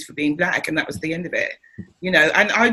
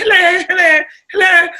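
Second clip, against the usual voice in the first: "Hello, hello, hello." much higher and louder; cheery posh hellos; 11 semitones higher, 8 dB louder than usual. Retold or imitated. Greeting strangers on London streets with loud high hellos. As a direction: trill out bright posh greetings. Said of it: "I was like".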